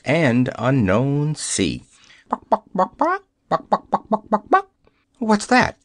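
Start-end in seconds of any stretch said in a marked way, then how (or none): 2.3-4.7 high, squeaky buck-buck clucks; hen clucking. Acted. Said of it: "Surely it was a hen cackling". 5.2-5.8 higher, quick startled question; startled cry. Acted. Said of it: "cried Dorothy".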